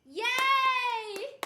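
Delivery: loud, high yay; excited cheer. Real, not acted. Cheering a friend's announcement.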